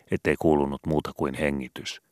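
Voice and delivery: ordinary delivery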